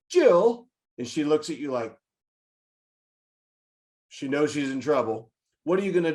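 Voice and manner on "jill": higher, louder call of "Jill"; sharp call. Retold or imitated. Toddler teacher catching the biter, sharp loud call of her name. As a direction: snap her name to stop her. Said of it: "Your instant reaction is".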